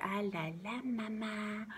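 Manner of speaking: put-on hushed baby-talk singsong; toddler's lullaby babble. Mother imitating her daughter's own version, soft toddler babble. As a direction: babble it very softly, like a little child. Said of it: "except in her own way".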